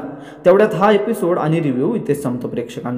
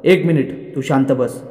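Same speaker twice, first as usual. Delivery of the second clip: ordinary delivery, nothing marked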